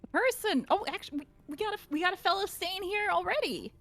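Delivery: high, bright host voice; eager host. Acted. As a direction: chirp it back eagerly. Host Gus answers eagerly.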